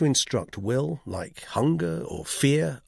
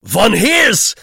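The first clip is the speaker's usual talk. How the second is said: loud, high, clipped French-speaking Swiss; emphatic Swiss man's French accent; 11 semitones higher, 13 dB louder than usual. A narrator's reading. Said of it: "pursued her husband in his clipped French".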